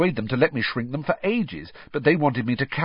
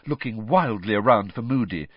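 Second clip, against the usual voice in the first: ordinary delivery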